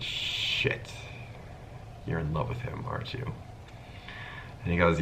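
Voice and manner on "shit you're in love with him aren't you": soft, breathy near-whisper; hushed realization. Retold or imitated. Narrator quietly realizing his crying friend's love, hushed. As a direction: say it under your breath, gently.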